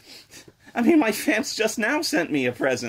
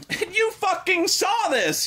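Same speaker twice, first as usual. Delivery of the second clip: slow high yell of disbelief; outraged; 9 semitones higher than usual. Real, not acted. Ranting at the fans who sent the game, a slow angry yell. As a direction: let the outrage climb.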